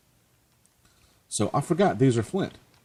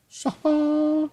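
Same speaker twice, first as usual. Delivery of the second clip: triumphant laugh. Real, not acted. Triumphant after placing block.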